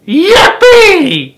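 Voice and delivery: very high, very loud whoop; joyful yell. A narrator's reading. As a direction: explode with joy. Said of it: "he shouted".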